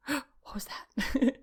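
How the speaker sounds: whispered, slow and startled; a frightened little whisper in an empty room. Retold or imitated. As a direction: hush it like you just heard something.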